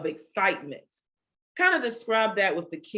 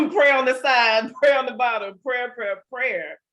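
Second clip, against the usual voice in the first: laughing; 6 semitones higher, 5 dB louder than usual. Real, not acted. Playful riff listing prayer, laughing.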